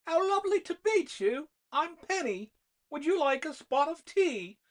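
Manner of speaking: put-on a child putting on a high, loud posh British accent; perfect British accent. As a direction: play-act a proper British lady offering tea, in a British accent. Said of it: "in a perfect British accent".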